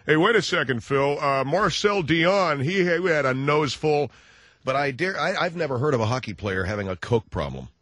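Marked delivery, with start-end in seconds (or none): 0.1-4.1 lightly voiced phone-in caller; objecting caller. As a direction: imitate a caller butting in with an objection. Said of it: "phone calls from people saying".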